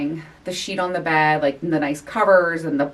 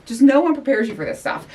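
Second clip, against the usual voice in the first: usual speaking voice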